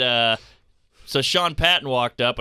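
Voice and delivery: usual speaking voice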